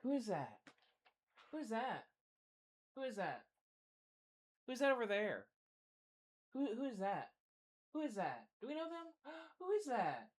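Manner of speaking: soft cooing dog talk, often high. Real, not acted. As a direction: coo softly at the dog, curious. Talking to the dog.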